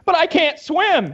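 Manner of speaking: whiny panic. Retold or imitated. Movie character scared.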